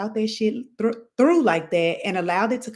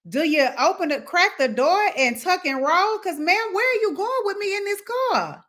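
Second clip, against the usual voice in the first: rising panic, words pitched higher and higher; alarmed disbelief; 9 semitones higher than usual. Pretend. A passenger panicking as the wife drives off, alarmed.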